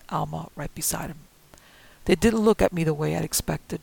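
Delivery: ordinary delivery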